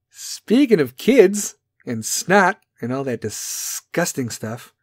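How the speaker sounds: ordinary talk, nothing marked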